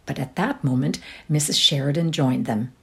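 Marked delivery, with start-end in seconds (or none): none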